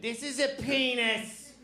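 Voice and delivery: Boston teacher lecturing the class. Retold or imitated. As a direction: imitate a fifth-grade Boston sex-ed teacher. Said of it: "She's like".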